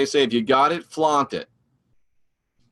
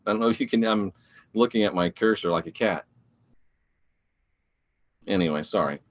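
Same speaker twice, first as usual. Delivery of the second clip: ordinary talk, nothing marked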